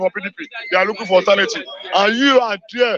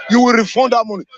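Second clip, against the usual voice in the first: ordinary talk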